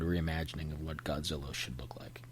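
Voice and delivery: unvoiced, hushed aside; a quiet whispered jab at the remake. Real, not acted. Snarky aside about the movie.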